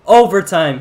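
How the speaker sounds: slow, loud overtime call; excited shout. Real, not acted. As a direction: announce it big.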